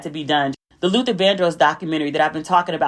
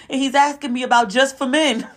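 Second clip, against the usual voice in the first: laughing; 6 semitones higher than usual. Real, not acted. Retelling a funny moment.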